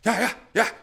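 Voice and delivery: high, yappy put-on dog voice; quick excited yelps of agreement; eager dog. Dog reaching the bird, eager high yapping. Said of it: "he runs to the bird and goes".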